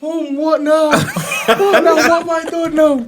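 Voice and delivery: high loud whining reply; exasperated son. Retold or imitated. Son answers mum's call again, exasperated high whine. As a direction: groan back at mum. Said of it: "And it's like".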